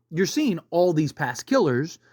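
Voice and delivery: usual speaking voice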